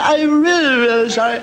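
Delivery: put-on high, crying drunk apology; mock sobbing drunk. Drunk sobbing his sorry to the priest, hammed-up crying. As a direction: wail it out like a weepy drunk.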